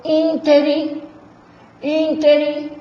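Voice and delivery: "ínterim" slowly, ÍN high and held; stress on ÍN. Shown as a demonstration. Teacher showing the tonic syllable, long ÍN in "ínterim". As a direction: stretch the first syllable of "ínterim". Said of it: "A sílaba tônica é o in".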